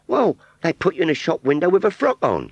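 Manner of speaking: put-on high Cockney girl's voice; rag trade Cockney. Scarecrow model answering Wurzel in high Cockney. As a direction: pert Cockney shopgirl reply. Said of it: "she said".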